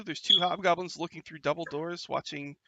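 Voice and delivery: normal talking voice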